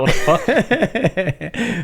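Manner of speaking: drawn-out, high mock Cockney "What the fuck". Guest mocks how a Cockney would react, in a jokey Cockney voice. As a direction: stretch it slowly in a jokey Cockney voice. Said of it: "If we were doing Cockney".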